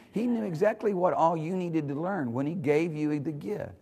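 ordinary talk, nothing marked

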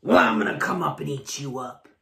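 low, fast troll threat; growling troll. A narrator's reading. Troll threatens big goat.

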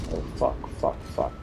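whispered curses under breath; scared whisper. Real, not acted. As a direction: hush it, frightened. Scared whisper in dark game.

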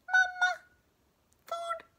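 very high squeaky chick cries; hatchling asking mama for food; tiny gosling peeps. Acted. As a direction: peep it like a hungry newborn.

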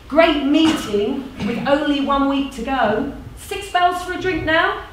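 upbeat meeting chair, lifting high at end; animated organiser proposing a drink; persuasive community organiser. Acted. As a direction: wrap up the meeting cheerily. Plays organiser closing meeting.

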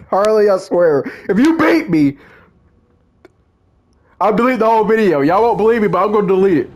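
mock threat. Real, not acted. Gamer warning Harley he'll delete her video, heated. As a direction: wind up the threat, voice climbing.

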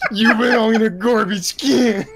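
high, slow, crying through laughter; laughing quote. Retold or imitated. Fan quoting a cartoon insult in a high voice, crying with laughter. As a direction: wail the line while cracking up.

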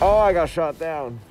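high, loud startled cry; surprised. Real, not acted. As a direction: caught off guard. Gets shot down in the game.

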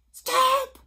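high, loud shout. A narrator's reading. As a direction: yell to halt the thief. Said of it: "shouted Harry".

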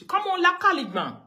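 loud, high taunting shout; shouted call-out. Real, not acted. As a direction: yell it out at him. Ranting at a critic.

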